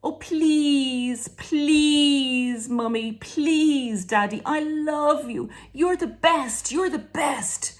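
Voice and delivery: put-on high, sugary pleading child voice; child sweet-talking parent, high and pleading; wheedling child. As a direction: beg and flatter like a little kid. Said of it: "like a child would".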